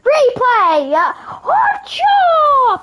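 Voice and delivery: high, loud hype yells; mock replay shouting. Gamer without an editor faking a replay, hyped shouting.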